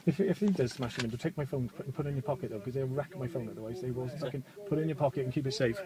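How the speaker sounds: hushed aside. Real, not acted. Driver murmurs aside to passenger.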